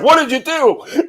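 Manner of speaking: high, slow accusing parent; retold parent. Parent blaming the kid, not the cops, accusing. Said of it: "It was like".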